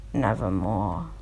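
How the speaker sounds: low, eerie raven voice; raven's reply. Acted. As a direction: drop deep into the bird's dark answer. The raven answers the narrator.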